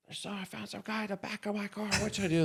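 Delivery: high, fast worried voice; hammed-up panicky driver; panicked vice president. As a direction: sound rattled and unsure.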